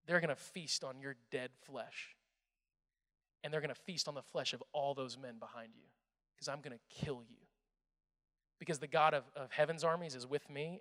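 low, soft, deadly calm warning; David quietly promising Goliath his fate; calm menace. Acted. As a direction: speak the threat quietly, no hurry.

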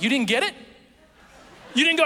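high, loud disbelieving questions; incredulous dad. Retold or imitated. Retold dad's incredulous questions.

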